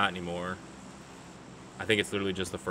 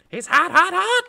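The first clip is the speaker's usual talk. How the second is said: high excited shout, much higher and louder; excited yell; 8 dB louder than usual. Real, not acted. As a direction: shriek it out in excitement. Enemy spotted, yelled excitedly.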